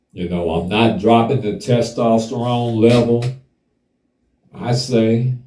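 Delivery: ordinary delivery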